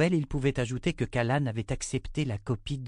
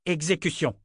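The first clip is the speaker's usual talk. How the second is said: higher, final order; curt command; 7 semitones higher than usual. Acted. Lord ordering the abbess to obey at once. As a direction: cut off all debate. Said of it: "ne laissait aucune place au débat".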